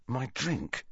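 soft, hushed realization; hushed, secretive awe; dropped voice. A narrator's reading. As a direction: drop your voice, scared and excited. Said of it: "Ron had dropped his voice".

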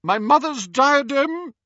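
high, loud outburst; losing composure. A narrator's reading. Ghost lets her mother slip out, agitated. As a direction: let it burst out. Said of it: "Her composure was slipping".

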